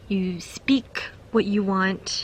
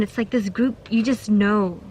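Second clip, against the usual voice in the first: usual speaking voice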